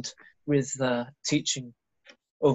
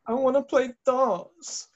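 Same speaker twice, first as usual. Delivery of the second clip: mocking voice; 11 semitones higher, slower than usual. Mocking Ollie.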